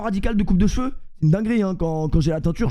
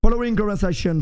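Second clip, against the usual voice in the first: put-on English announcement in a French accent. Boastful streamer showing off English to viewers, French-accented English. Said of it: "le streamer qui parle le mieux anglais de France".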